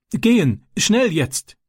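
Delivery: high, shaky, urgent plea; a scared boy pushing to leave at once; trembling fear. A narrator's reading. Ram, dreading his father, answers in a high trembling voice. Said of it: "antwortete er zitternd".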